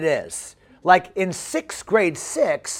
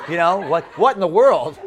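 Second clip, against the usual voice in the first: normal talking voice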